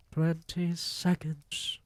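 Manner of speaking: lightly voiced witch counting slowly; Grand High Witch. A narrator's reading. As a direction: draw out the count like a gloating witch. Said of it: "cried the Grand High Witch".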